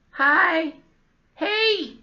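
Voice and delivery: very high, tiny squeaky greeting; squeaky old guy hello. Acted. Old guy greeting the kids, very high squeak. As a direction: squeak out a hi like a toddler.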